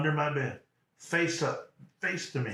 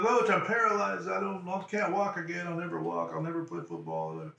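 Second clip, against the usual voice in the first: high-pitched retold wail, sinking at the end; mock crying; 5 semitones higher than usual. Paralyzed player to his coach, mock tearful protest. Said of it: "And I started crying".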